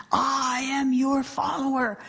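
drawn-out, chant-like declaration; devout meditator. Acted. Meditator answers God's voice, acted devout, sustained declaration. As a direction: proclaim devotion to God, sustaining the words.

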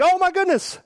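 very high-pitched mock panic gasp; alarmed parent. Parent reacting to a fallen child, acted high-pitched alarm. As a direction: overreact like a parent who saw the fall. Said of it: "if the parents are like".

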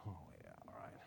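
low, soft dismissive mumble. Retold or imitated. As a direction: brush it off under your breath. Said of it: "the other one's going".

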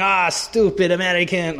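mock French-accented Italian scoffer. Italian audience laughing at stupid Americans, in a put-on French accent. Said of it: "I do a French accent for an Italian".

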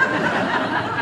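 quiet breathy chuckle at own joke; short soft laugh. Real, not acted. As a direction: laugh off the self-jab. Jokes about himself as mayor.

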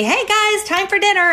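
high call down the stairs. Retold or imitated. Mom calling family to dinner, high call. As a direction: sing out to the family below. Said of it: "yell down to my family".

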